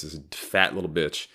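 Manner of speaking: ordinary talk, nothing marked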